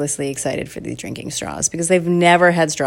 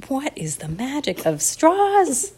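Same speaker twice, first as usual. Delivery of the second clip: slow, higher-pitched marvelling; baffled wonder; 9 semitones higher, slower than usual. Acted. Parents watch happy kids, in slow baffled amazement. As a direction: draw it out in exaggerated wonder.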